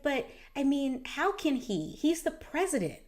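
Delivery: put-on child asking mom how the president could say that; childlike disbelief. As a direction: ask it like a confused child. Daughter's bewildered question.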